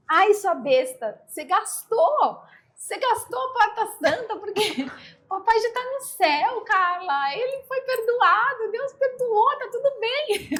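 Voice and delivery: voz de irmã, bem mais aguda e alta, rindo; imitando a irmã. Retold or imitated. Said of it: "minha irmã virou pra mim e falou". (imitação da irmã debochando, aguda e risonha)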